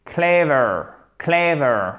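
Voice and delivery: Clever stressed on CLE, falling pitch; first-syllable stress. Shown as a demonstration. Teacher repeats the model, CLE-ver stressed first. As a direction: land on the start of clever, let it fall. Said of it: "on fait bien l'accent tonique".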